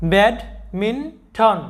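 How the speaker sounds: Badminton stretched very slowly, syllable by syllable; teacher slowly models badminton; slow demo pronunciation. Shown as a demonstration. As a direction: draw out badminton slowly for students to copy. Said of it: "তাহলে আমাদের এই উচ্চারণটা কী হবে?".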